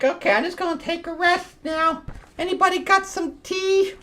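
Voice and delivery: high, silly falsetto; mock post-battle casualness. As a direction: ask airily in a put-on high voice. Voicing characters after the fight.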